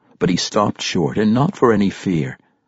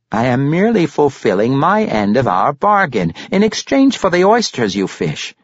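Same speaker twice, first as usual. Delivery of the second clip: higher, louder grandfather voice; self-justifying Pericolo; 9 semitones higher, 5 dB louder than usual. Acted. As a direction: defend yourself in character. Narrator voices Pericolo defending himself.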